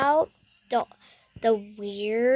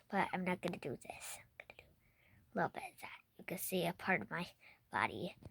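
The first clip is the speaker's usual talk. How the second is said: whisper; 5 semitones lower, 13 dB quieter, much faster than usual. Real, not acted. Secretive camera talk to fans.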